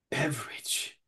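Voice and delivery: whispered punchline; whispering the word beverage. Acted.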